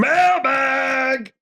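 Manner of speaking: high, long-held announcer shout; blasted segment call. Pretend. Co-host announcing the mailbag segment, playful drawn-out shout. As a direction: belt it out like a jingle, stretched high.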